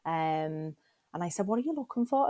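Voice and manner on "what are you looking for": worried question. Retold or imitated. Retelling the mammogram, she asks nurse.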